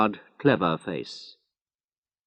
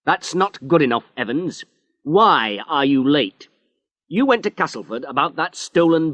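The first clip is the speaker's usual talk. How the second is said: angry; 9 semitones higher, 4 dB louder than usual. Acted. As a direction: tear into him like an angry boss. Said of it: "He was angry now".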